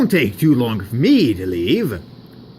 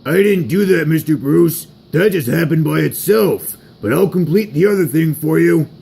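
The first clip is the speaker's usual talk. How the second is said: raised, louder henchman voice; eager underling; 4 semitones higher, 4 dB louder than usual. Acted. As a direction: report back to the boss in a put-on higher voice. George answers Mister Bruce.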